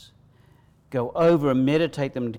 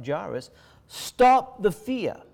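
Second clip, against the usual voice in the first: higher, louder, stop the fear stretched out slowly; slow command; 6 semitones higher, 4 dB louder, slower than usual. Acted. Preacher speaking as Jesus to the congregation, slow and firm. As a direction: lean hard on every word. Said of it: "like Jesus told Jairus".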